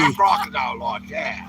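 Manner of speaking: put-on loud Australian accent on crocodile. Santa to the vlog host, loud mock Australian accent. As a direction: belt it out like an Aussie croc hunter. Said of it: "That was my, uh, Australian accent".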